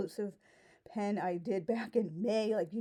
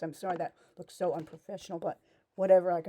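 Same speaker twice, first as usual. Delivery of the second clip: ordinary talk, nothing marked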